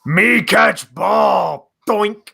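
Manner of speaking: slow, loud caveman brute shouting; slow, loud meathead roar; dumb jock voice. Retold or imitated. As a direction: play a roid-raging meathead. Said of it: "super jacked from the roids".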